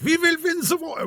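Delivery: very high, crazed German accent; over-the-top German accent. Shown as a demonstration. Narrator showing the Hitler he avoided, crazy German accent. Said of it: "I tried not to go too crazy".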